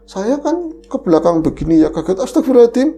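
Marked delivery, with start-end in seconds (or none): 2.2-2.9 sudden shocked exclamation, pitched up; startled gasp of prayer. Retold or imitated. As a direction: blurt it out in fright. Startled exclamation in retold scare. (kaget berseru istigfar)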